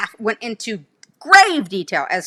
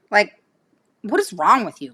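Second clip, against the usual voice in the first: ordinary delivery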